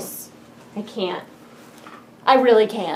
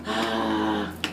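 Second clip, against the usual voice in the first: sharp gasp; 10 dB quieter than usual. Real, not acted. Gasps at the box.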